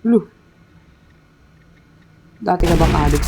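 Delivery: normal talking voice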